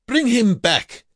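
very high, loud command; shout. A narrator's reading. Guard calling a prisoner back, high loud shout. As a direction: yell the order at top pitch. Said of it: "shouted the guard".

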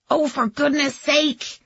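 put-on slow, high exasperated old dame; huffy grande dame, drawn out; quavering imperious voice. A narrator's reading. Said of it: "the quavering, imperious voice".

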